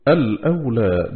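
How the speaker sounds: الأولى said as "al-ūlā", opening hamza kept, lam silent and a hamza after it; third reading demo. Shown as a demonstration. Reciter demonstrating the third way of starting, الأولى with a silent lam. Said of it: "بإثبات همزة الوصل وإسكان اللام".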